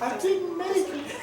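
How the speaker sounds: high, slow, tiny spirit voice; a small sad ghost speaking; little ghost voice. Acted. GM voices dead elf spirit.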